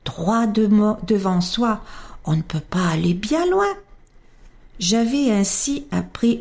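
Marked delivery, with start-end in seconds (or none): none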